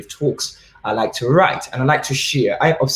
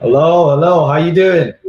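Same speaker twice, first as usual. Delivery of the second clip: cheerful hello; 5 semitones higher, 7 dB louder than usual. Real, not acted. Speaker greets the host cheerfully.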